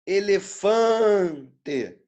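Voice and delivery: Elefante with FAN held very long and loud; stretched tonic syllable. Shown as a demonstration. Teacher showing the nasal tonic syllable, long FAN in Elefante. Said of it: "Tem vogal nasal".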